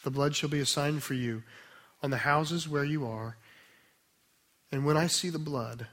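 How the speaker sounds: soft, low, measured reading with long pauses between phrases; slow scripture reading. Real, not acted. Preacher asking listeners to be with the scripture, slow reverent reading. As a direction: read the verses quietly and unhurried, letting each phrase settle. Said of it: "I'm gonna read them slowly".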